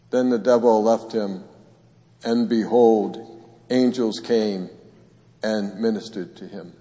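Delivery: measured, solemn liturgical reading; gospel reading. Acted. Priest reading the gospel at Mass, solemn proclaiming.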